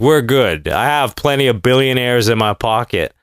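usual speaking voice

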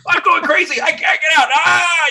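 high, loud, frantic squeal of a trapped bird; panicked trapped bird. Retold or imitated. Trapped bird screaming it can't get out, high and loud. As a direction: voice the bird losing its mind, shrill and loud. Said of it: "he's like".

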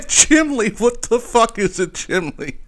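high, laughing disbelief; incredulous laughter. Real, not acted. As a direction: crack up while questioning the word. Laughing at the wrong word.

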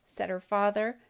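lively storybook read-aloud voice; story narration. A narrator's reading. Host reading a picture book to children, animated.

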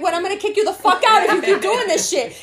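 laughing, high, loud threat; hammed-up angry parent, laughing; mock angry threat. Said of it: "you should be like".

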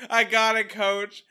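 loud, high player's shout; mock calling out. Imagined player answering the coach, mock calling out. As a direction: call it out to the coach in mock eagerness.

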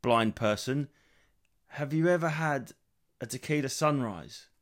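slow, drawn-out hammed-up invitation; mock host voice. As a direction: lay it on slowly like a tour guide. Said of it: "and you say".

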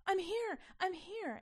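high, loud and joyful; a child bursting with relief; excited shout. Acted. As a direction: yell it up high, overjoyed. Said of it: "Annalee shouted".

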